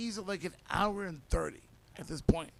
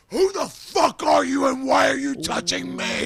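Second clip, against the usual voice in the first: higher, louder mock roar; angry waking shout; 7 semitones higher, 9 dB louder than usual. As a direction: bark it at a stranger. Friend wakes up angry.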